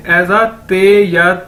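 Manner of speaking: ते said slowly; slow, deliberate teacher delivery; slow teaching. Shown as a demonstration. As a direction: spell out ते for the learners. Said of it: "डी को हम कैसे pronounce करेंगे".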